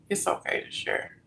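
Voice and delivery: whispered reassurance. Shown as a demonstration. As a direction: breathe it out as a whisper.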